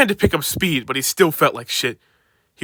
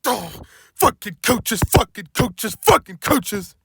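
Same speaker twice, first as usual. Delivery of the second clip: grumbling; 5 semitones higher, slower than usual. Acted. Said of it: "he grumbled".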